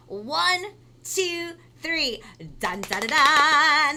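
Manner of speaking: building drum roll countdown; excited countdown. Acted. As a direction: count up to the big reveal.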